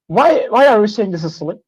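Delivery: ordinary talk, nothing marked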